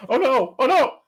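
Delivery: high, loud panicked cry; mock panic. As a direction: cry out as the weasels bite. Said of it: "You're like".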